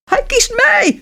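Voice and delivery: high-pitched greedy squeal; shrieking. A narrator's reading. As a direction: squeal it out like a spoiled girl. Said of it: "gilde de oudste".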